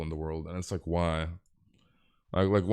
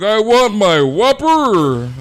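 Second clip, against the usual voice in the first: loud, high, drawn-out mock demand; hungry customer's yell; 10 semitones higher, 11 dB louder, much slower than usual. Starving Burger King customer demanding a Whopper, hammed-up loud yell. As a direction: bellow it slowly like a starving customer. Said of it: "He's freaking hungry as hell".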